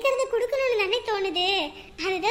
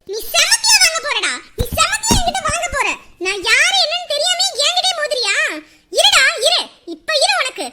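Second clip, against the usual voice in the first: loud, rising fury; shouting threats at the man, then ordering her friend to stop; angry shouting; 3 semitones higher, 6 dB louder than usual. Acted. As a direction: build the rage to a yell. Raging at the teasing man.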